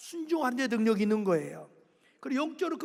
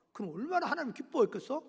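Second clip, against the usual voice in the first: ordinary delivery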